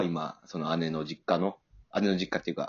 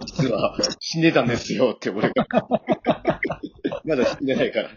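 laughing through a joke; cracking up at own joke; 6 semitones higher, 6 dB louder than usual. Real, not acted. Joking fake ghost twist.

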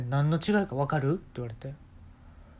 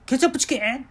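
high, loud excited question; excited brother; 10 semitones higher, 5 dB louder, faster than usual. Retold or imitated. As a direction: perk up at the dish name, pitched high. (テンションの上がった兄)

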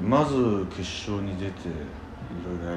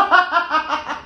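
burst of laughter; 6 dB louder than usual. Real, not acted. Reacts to joke.

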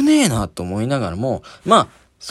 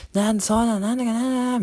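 imitating a drunk man; 9 semitones higher, 5 dB quieter than usual. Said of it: "もう一人の男も".

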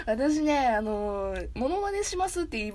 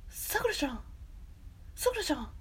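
soft, higher, gentle character call; Yukito; 5 semitones higher, 6 dB quieter than usual. Acted. Anime boy calling Sakura, soft gentle imitation. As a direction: lift it lightly and hush it. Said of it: "ゆきとさんの声。".

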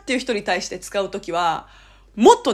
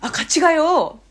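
higher, coaxing invitation with stretched ending; friendly emphatic nudge; 7 semitones higher, 4 dB louder, slower than usual. Shown as a demonstration. Closing the distance with someone, eagerly coaxing. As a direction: urge them along brightly, stretching the end. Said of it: "っていう風に言った方が絶対にいい".

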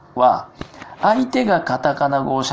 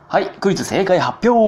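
high, louder show announcement; announcer call; 9 semitones higher, 4 dB louder than usual. Real, not acted. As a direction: call out the quiz segment, stretching the last word. Host calls out quiz announcement.

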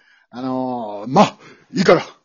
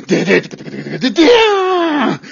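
shouting a metal guitar riff with a laugh; mouth guitar riff; 4 semitones higher than usual. Acted. Teacher imitating his own guitar sound to the student. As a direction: belt out the riff like a power chord.

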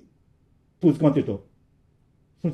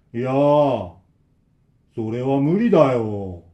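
put-on slow, louder, creepy old man; ominous refusal; 4 dB louder, much slower than usual. Old man behind the door refuses, slow and sinister. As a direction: refuse coldly and slowly.

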